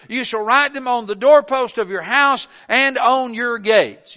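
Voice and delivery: reading scripture aloud from the pulpit. Acted. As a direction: proclaim the verses to the church. Reads Deuteronomy aloud.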